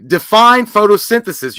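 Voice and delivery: loud, high, slow teacher demand; stern teacher. Retold or imitated. Cohost mimicking a teacher quizzing an unprepared student, loud and slow. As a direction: bark the question like a strict teacher. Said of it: "the teachers ask you".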